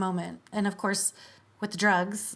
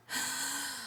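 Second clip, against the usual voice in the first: sharp startled gasp, softer; sudden gasp; 4 semitones higher, 12 dB quieter than usual. Retold or imitated. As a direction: suck in air as if jolted awake. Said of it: "we all went".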